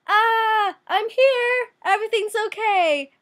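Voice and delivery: high-pitched spirit woman, loud, with a crying catch. Retold or imitated. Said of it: "the woman spirit comes to him and goes".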